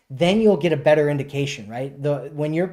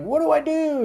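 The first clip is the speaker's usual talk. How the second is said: much higher, lightly voiced helpless asker; helpless question; 12 semitones higher than usual. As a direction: squeak it out like you're lost. Both people stuck, acted helpless question.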